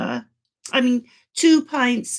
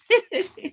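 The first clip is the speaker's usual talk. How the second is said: short laugh; 9 semitones higher than usual. Real, not acted. Laughs at popping a button.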